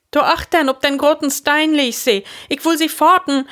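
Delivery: high, loud, agitated telling; excited account. A narrator's reading. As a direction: report where the danger was, high and worked up. Hein excitedly points out snake's place. (hooch un opgeregt)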